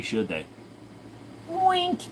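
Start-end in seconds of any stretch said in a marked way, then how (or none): 1.5-2.0 squeaky wink. Pretend.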